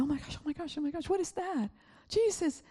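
high-pitched dismayed exclamations; retold shock. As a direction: relive the shock of bad news, voice jumping up. Retold shock at hearing of loss.

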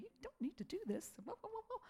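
high, mocking inner voice; nagging emotions. Emotions telling her to quit, mocking and dismissive. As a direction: wave off the excuse in a mock voice. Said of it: "my emotions were kicking in and saying".

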